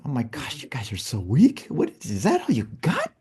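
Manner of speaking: imitating a scoffing winner, rising high at the end; mock amused disbelief. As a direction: tease them in mock disbelief, pitch leaping on the question. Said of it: "you laugh at us going".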